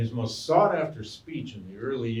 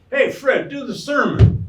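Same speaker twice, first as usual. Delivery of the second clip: higher, louder shout from the crowd; audience member calling out; 13 semitones higher, 13 dB louder than usual. Retold or imitated. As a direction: yell across the hall, egging him on. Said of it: "somebody in the audience would say".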